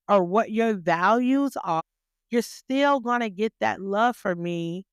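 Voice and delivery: ordinary talk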